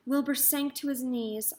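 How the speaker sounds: ordinary delivery, nothing marked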